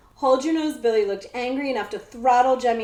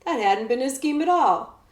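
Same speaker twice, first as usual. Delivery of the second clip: ordinary delivery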